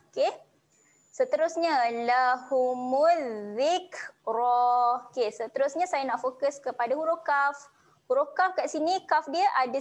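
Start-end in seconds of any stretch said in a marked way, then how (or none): 2.1-5.1 lahumul zikra very slow and drawn out; recited demo. Shown as a demonstration. Teacher demonstrates the phrase for students, slowly chanted. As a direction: chant it with nasal hum. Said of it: "dengung kemudian panjangkan dua harokat".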